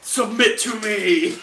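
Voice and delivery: slow, drawn-out command; mock villain demanding obedience. Plays a commanding attacker.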